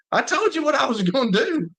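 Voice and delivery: high, mock-triumphant boast; Harbaugh's boast. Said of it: "he's like".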